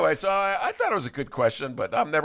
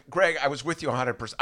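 ordinary talk, nothing marked

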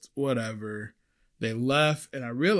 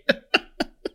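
unvoiced, wheezy burst of laughing; real laughter; 4 dB louder than usual. Real, not acted. Server tickled by his own revenge confession, laughing. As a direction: crack up at your own confession.

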